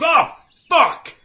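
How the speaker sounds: high, loud startled curse; retold angry shout. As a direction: yell it like you just slammed into a pothole. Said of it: "I immediately get pissed off".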